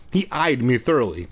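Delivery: usual speaking voice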